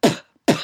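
"p" spat out with a hard burst of air; harsh plosive "p"; popped p. Shown as a demonstration. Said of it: "not p".

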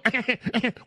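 short high burst of laughter; amused high laugh; real laugh. Real, not acted. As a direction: laugh out at the rant. Host laughs at caller's rant.

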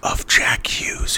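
breathy theatrical whisper, no voice; stage whisper. Pretend. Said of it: "Stage whisper".

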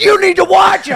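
high, loud scolding shout; hammed-up road-rage holler; retold angry warning. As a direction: re-enact yelling through a car window.

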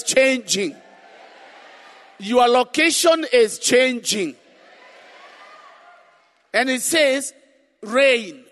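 loud fervent sermon; preaching. Real, not acted. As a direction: preach it with full force. Preaching at full force.

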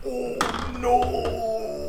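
alarmed. Real, not acted. Huge rock falls.

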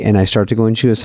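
ordinary talk

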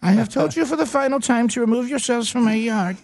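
high, mock-stern German accent; German granny. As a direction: give a final warning as a comic German granny. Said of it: "If your grandmother speaks like a German".

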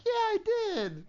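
put-on squeaky little kid voice; high kid voice. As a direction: answer like a small child. Kid answering the teasing.

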